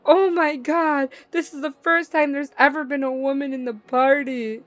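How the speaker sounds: hammed-up gasp of disbelief; mocking gamer guys. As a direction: ham up an awestruck gamer. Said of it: "the men are all just like".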